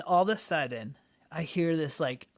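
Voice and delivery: ordinary talk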